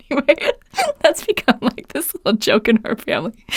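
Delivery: real giggles through the words; amused laughter. Real, not acted. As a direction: recover from a laugh while talking. Laughing at her son's punchline.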